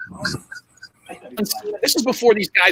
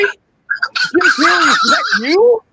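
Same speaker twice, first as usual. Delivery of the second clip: higher, louder, slow drawn-out name; amazed recognition; 9 semitones higher, 4 dB louder, much slower than usual. Retold or imitated. Wrestler recognizing his old friend under the bucket, drawn-out amazement. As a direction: stretch the name out in disbelief.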